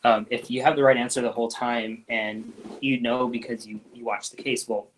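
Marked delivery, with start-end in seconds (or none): none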